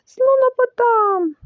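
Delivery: high, very drawn-out wail; winded, frightened cry. A narrator's reading. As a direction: drag the word out high, barely getting it out. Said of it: "що ледь міг вимовити слово". (протяжний переляканий зойк)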